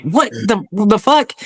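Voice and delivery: a cracked, incredulous "what the"; shocked disbelief. Retold or imitated. Veteran retelling his shock at the drill sergeant's joke, startled.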